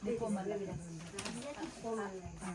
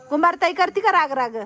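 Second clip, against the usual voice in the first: playful call, higher and louder; loud teasing; 7 semitones higher, 9 dB louder than usual. Real, not acted. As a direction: tease her loudly across the room. Teasing an elder loudly.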